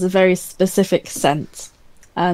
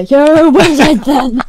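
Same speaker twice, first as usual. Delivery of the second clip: exasperated outburst, higher and louder; 8 semitones higher, 8 dB louder than usual. Acted. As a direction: give up, throwing it out loud. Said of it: "I just throw my hands up".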